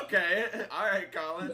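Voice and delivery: high, loud laughing comeback; mock-offended, cracking up mid-phrase; laughing protest. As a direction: push back loudly through laughter. Retelling own reaction to the teasing.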